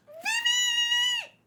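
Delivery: very high, loud fan scream of a name; ecstatic shriek. Real, not acted. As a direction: scream it like a thrilled fangirl. Fan screams favourite character's name.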